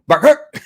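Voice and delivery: high, loud imitated single bark; dog bark imitation. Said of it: "your sound effects are spot on".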